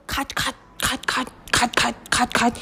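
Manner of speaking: soft, rapid cut cut chant; whisper-chant the chewing; quiet gnawing cut. Acted. Mouse gnawing the rope, soft quick chant.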